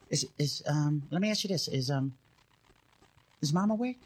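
low, halting, hesitant question; sly little brother. Retold or imitated. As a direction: imitate a kid cagily feeling out a plan. Little brother cautiously asking about Mom.

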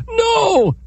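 very high pleading cry; mock plea. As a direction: fake a panicked no. Turtle pleads against river.

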